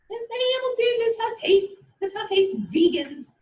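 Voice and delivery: high mock-offended student voice; protesting student. Teacher mocking a student objecting to her, high mock outrage. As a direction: squeak it out like an offended pupil.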